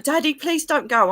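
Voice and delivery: high, loud imitation of begging; retold tearful pleading. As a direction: plead like a child, acting out tears. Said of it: "I'm crying my eyes out".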